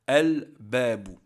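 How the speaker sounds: slow, loud "Al-babu" with a clear L; lam pronounced. Shown as a demonstration. Teacher giving the qamariya example, clearly pronounced L in "Al-babu". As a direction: say "Al-babu" slowly, sounding the L fully before the B. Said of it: "le lam on le prononce".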